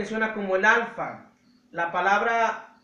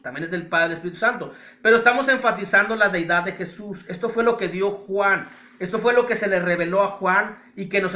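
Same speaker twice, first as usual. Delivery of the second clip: louder, emphatic pulpit preaching; fervent sermon voice, rising to a proclamation; full-force preaching; 5 dB louder than usual. Acted. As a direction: drive each phrase home to the congregation.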